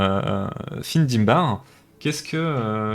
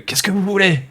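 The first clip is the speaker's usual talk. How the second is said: higher-pitched, rattled challenge; acted alarm, pitched up; intimidated captive; 5 semitones higher, faster than usual. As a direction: protest nervously at the armed stranger. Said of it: "intimidé par ta présence".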